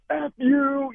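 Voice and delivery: high-pitched retold cuss-out; retold angry outburst. Quarterback cursing a rival at midfield, high mock-angry retort. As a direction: fling it at him in mock fury. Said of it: "laying into him".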